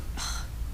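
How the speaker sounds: soft breathy sigh; weary sigh; tired sigh. Real, not acted. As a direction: sigh in despair. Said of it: "exasperated sigh".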